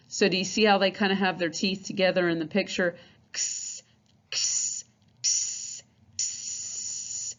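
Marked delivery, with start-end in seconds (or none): 3.3-7.3 unvoiced ks, air forced out; breathy X sound. Shown as a demonstration. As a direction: push air through the teeth, ks. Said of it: "Kinda forcing air out".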